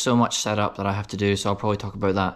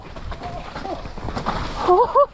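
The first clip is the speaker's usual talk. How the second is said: thrilled giggle. Real, not acted. Laughing after a thrilling run.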